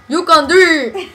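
loud, slow mock-Southern encouragement; reading teacher impression. Friend shows how the reading teacher says it, exaggerated loud drawl. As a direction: stretch it out like an over-keen teacher. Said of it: "reading teacher. She's just like".

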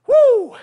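loud, high excited woo; hype whoop. Real, not acted. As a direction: let out a big workout whoop. Coach hypes up the workout.

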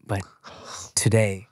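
corny heartfelt quote. Retold or imitated. Host mocking a reality show contestant's sappy farewell, in a mildly hammed-up earnest voice. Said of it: "corny Lifetime movie quote".

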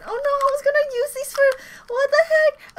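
high, startled oh no; sudden high alarm; shocked dismay. Real, not acted. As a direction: yelp at the broken pair, pitch jumping up. Finds headphones unusable.

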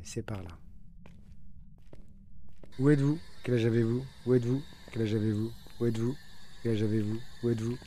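usual speaking voice

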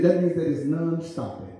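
ordinary delivery

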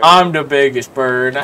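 slow, loud chest-puffed boast; mock big boast. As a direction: boom it like the king.

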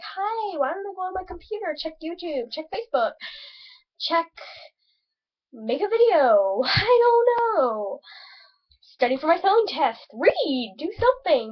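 high, singsong self-talk; acted bored musing. Acts out own bored self-talk.

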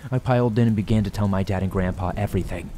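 ordinary delivery, nothing marked

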